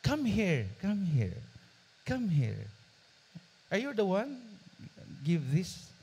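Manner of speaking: gentle, coaxing employer voice; softened voice. Retold or imitated. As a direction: soften the voice, calling her over kindly. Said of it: "lumambot ang boses".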